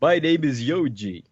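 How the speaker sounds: slow, hammed-up character voice with a laugh behind it; deliberately silly put-on voice; put-on Yonji voice. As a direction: play a silly cartoon villain introducing himself. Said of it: "with this voice specifically".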